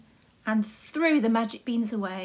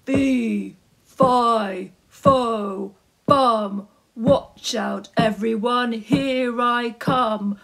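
loud, slow giant voice; booming giant; 6 dB louder, much slower than usual. Acted. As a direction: boom it out like a hungry giant. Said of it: "a voice called out".

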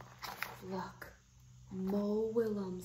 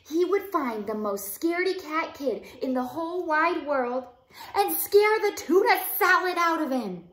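loud, high, big read-aloud narration; animated picture-book reading; dramatic storytelling; 3 semitones higher, 11 dB louder than usual. Acted. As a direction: perform the story to hold a small child.

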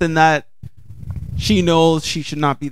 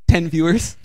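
usual speaking voice

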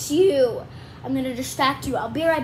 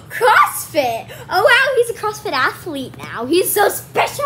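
loud, exaggerated gushing; mock impressed; 5 semitones higher, 7 dB louder than usual. Kid teases Dad about CrossFit, mock gushing. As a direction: gasp and gush teasingly, higher and louder.

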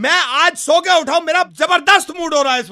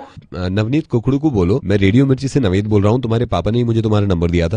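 much lower, gruff prank-caller voice; deep put-on voice. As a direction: play a deep-voiced grown man scolding a kid. Deep voice reveals radio prank.

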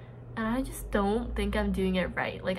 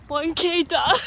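breathless laughing, higher and louder, slow; laughing triumph; 8 semitones higher, 8 dB louder than usual. Real, not acted. To camera after the first kilometer, laughing and louder.

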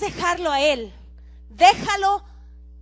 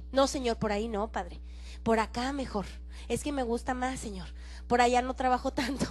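low, soft wheedling plea; pleading mock whine; 5 semitones lower, 7 dB quieter than usual. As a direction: coax God under your breath. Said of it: "decirle a Jesús". (súplica baja y quejumbrosa)

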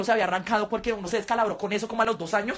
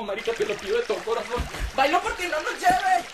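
pleading fish; 3 semitones higher than usual. Pretend. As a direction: beg like a terrified little fish. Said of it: "las bailarinas moviendo su cola como".

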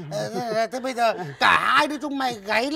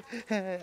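sly chuckle; 9 dB quieter than usual. Acted. Lecherous landlord chuckles.

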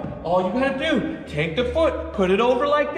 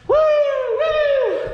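very high cheering woo; excited whoop; 4 dB louder than usual. Real, not acted. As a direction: whoop with joy at the win. Celebrating the landed trick.

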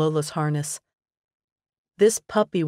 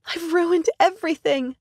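high, tearful confession, ruined stressed; holding back tears; 13 semitones higher, 3 dB louder than usual. A narrator's reading. Woman confessing to her friend, near tears. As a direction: near crying as she admits it. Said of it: "body shuddering with repressed tears".

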